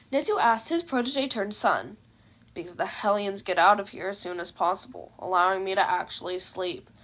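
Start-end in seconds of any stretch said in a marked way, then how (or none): 2.5-6.9 tired, dismissive, get-lost tone; weary brush-off, lightly done; go-away voice. A narrator's reading. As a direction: make it clear you want them gone. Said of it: "a voice that basically screamed to go away".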